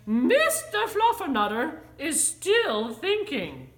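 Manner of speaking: loud, emphatic, swooping reply; exasperated mother. A narrator's reading. Mother explains her kitten is still thinking, exasperated. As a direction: say it with stern mock formality.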